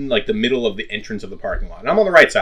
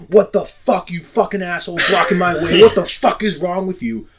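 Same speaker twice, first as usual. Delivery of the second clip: mock yell, pitched up; higher-pitched retold rant at someone blocking the way; 6 semitones higher, 3 dB louder than usual. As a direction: imitate an angry driver yelling, a bit higher. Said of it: "he starts yelling at me".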